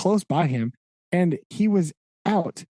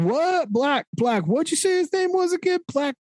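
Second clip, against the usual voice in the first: much higher, hammed-up puzzled fumbling over a name; feigned confusion; 8 semitones higher than usual. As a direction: ham up pretending not to know the man. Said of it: "all the feigned".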